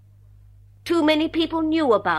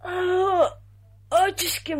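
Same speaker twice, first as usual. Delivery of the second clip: startled gasp; 7 semitones higher, slower than usual. Acted. Startled woman caught off guard.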